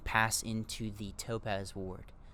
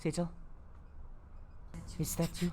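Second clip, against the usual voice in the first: muffled voice; 8 semitones higher, 4 dB quieter, much faster than usual. Acted. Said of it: "you hear a muffled voice".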